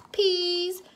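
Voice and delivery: put-on high, drawn-out baby plea; Baby Louie. A narrator's reading. Baby joins the begging, high toddler plea. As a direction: plead in a little toddler voice. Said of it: "Baby Louie said".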